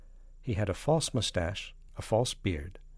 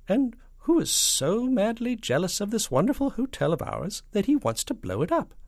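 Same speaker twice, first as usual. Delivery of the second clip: high, loud, leading question; falsetto; 13 semitones higher, 6 dB louder than usual. A narrator's reading. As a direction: quiz the boss in falsetto. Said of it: "and a falsetto voice".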